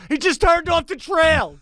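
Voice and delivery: very high-pitched, exasperated report; escalating mock panic. Scroll operator reporting Biden off script, escalating mock panic. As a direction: raise the alarm in a comically high voice.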